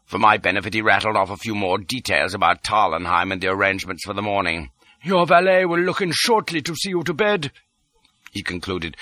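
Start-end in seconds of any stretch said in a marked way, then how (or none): 5.0-7.5 high, formal character voice; pitched up, brisk instruction; Detchard, in command. A narrator's reading. Detchard tells the impostor about the valet, formal and high.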